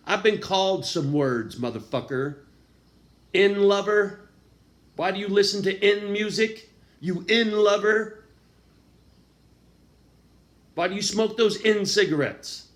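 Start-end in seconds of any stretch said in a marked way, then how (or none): 3.3-12.7 high, loud sneering mimicry of the haters; mocking haters. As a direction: throw their taunts back, louder and higher. Mocking what haters say to him.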